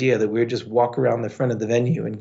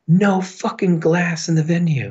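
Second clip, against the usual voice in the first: imitating a bouncer's higher-pitched order; aggressive bouncer; 7 semitones higher than usual. Bouncer confronts drinker over glass in the venue, aggressive. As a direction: retell it as an aggressive, raised-pitch command. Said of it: "just really aggressive".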